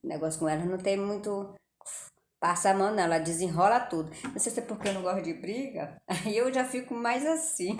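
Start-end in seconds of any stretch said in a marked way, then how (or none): 6.1-7.8 fala entre risos; rindo. Real, not acted. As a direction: diz rindo de si mesma. Laughs while saying it. (frase dita entre risadas)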